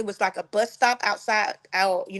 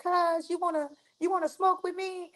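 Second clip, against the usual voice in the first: cousin's voice; 8 semitones higher, 5 dB quieter than usual. Retold or imitated. Cousin voiced offering a joint.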